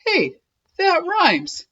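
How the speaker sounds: high, delighted cry; excited cry. Acted. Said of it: "he cried".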